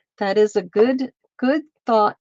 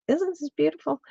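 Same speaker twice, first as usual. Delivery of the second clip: higher, softer and delighted; delighted softer repeat; delighted higher echo; 7 semitones higher, 4 dB quieter, faster than usual. Real, not acted. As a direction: coo over the yarn up high. Admiring the yarn again.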